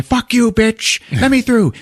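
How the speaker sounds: high shrieking mock yell; mock Sarah Connor yell. Sarah Connor shoving past the guards, high shrieking yell. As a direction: squeal it like a furious heroine.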